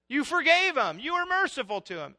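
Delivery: high, resentful accusation; angry Jonah. Acted. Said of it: "Jonah's basically saying".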